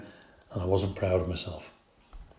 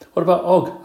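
eager question; 8 semitones higher, 8 dB louder than usual. Acted. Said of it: "asked Richie".

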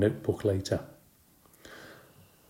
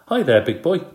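cooing; 9 semitones higher, 7 dB louder than usual. Acted. Babysitter cooing at hamster.

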